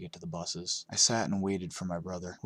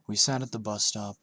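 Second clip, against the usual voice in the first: ordinary delivery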